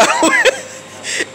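real laugh, very high and loud. Real, not acted. Shopper amused by her own market story, high laughing. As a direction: let a high laugh spill out.

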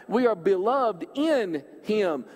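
slow, "in" stretched and pressed; stress on in. Shown as a demonstration. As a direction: dwell on "in" before "him". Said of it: "Emphasis on the word in".

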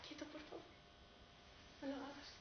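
urgent but very quiet appeal; hushed plea. Acted. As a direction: beg him under your breath to stop. Wife pleads with husband to stop.

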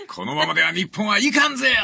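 rally them in Ryoma's Tosa dialect; high dramatic Tosa-dialect warning; rousing Tosa dialect. Shown as a demonstration. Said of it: "土佐弁で日本を心配したからだ".

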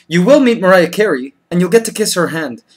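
ordinary delivery

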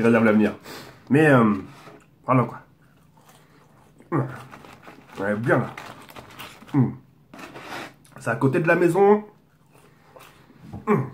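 chewing while talking; talking between bites of fries; mouth full. Real, not acted. Seen 1: picks fries, puts them in mouth, chews while speaking.

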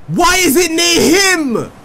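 loud, high, slow alarmed cry; panicked shout. Real, not acted. Car spawned beside enemy.

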